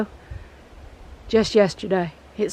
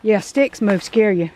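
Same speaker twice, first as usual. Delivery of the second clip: normal talking voice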